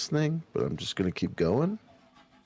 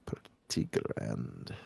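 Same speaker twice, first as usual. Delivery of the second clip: deep slow voice; 12 semitones lower, 8 dB quieter, much slower than usual. Pretend. Deep slow mock-grand comment.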